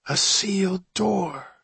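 high, slow, hushed wonder; awestruck whisper. A narrator's reading. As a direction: draw it out in breathy awe. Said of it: "Johnny whispered, awestruck".

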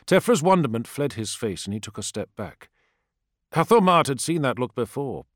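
ordinary delivery

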